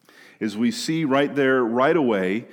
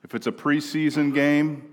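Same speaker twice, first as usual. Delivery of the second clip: normal talking voice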